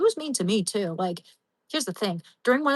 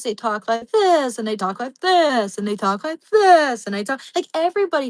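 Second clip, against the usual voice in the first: mock college cadence; 4 semitones higher, 6 dB louder than usual. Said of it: "this certain cadence and lilt".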